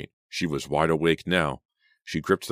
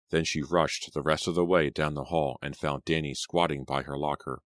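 ordinary delivery

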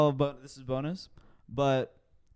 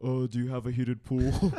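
fake adult voice; 3 semitones lower than usual. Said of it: "I wanted to sound like an adult".

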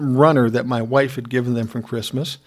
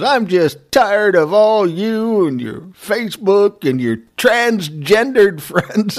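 slow mock sneer; 5 semitones higher, 3 dB louder, slower than usual. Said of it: "he goes".

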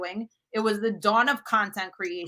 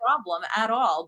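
usual speaking voice

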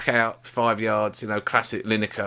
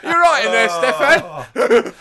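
high, loud comic check-in; calling out; 12 semitones higher, 5 dB louder, much slower than usual. Acted. Teammate calls to Stefan in the toilet, mock calling out. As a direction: call through a door, hammed-up.

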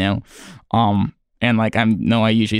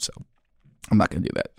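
normal talking voice

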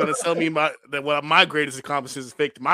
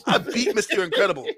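much higher, cocky villain voice, a bit fast at first; Syndrome taunting; faster than usual. Retold or imitated. Syndrome mocking the weak opponent he must fight, high cocky taunt. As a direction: sneer like a smug cartoon villain.

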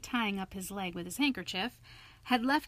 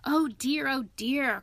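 mildly despairing, higher and louder; exasperated lament, raised pitch; despairing cry; 5 semitones higher, 5 dB louder than usual. A narrator's reading. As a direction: throw up your hands in despair. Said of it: "cried the rat in despair".

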